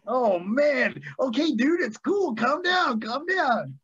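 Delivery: high-pitched placating plea; retold pleading. Retelling his plea to calm Sting.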